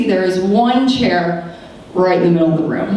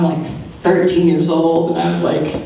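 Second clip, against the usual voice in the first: ordinary talk, nothing marked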